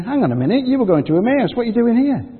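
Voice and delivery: high, loud, incredulous questioning; astonished. Retold or imitated. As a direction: act out startled disbelief at seeing them back. Disciples surprised at returning pair.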